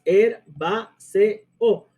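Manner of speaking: each syllable of herbáceo separated by a pause; four syllables. Shown as a demonstration. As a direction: count out herbáceo beat by beat. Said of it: "Cuatro sílabas".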